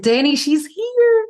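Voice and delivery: high, loud, drawn-out shout; calling out. Retold or imitated. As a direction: shout the discovery to your partner, stretching it out. Retold calling out on finding her.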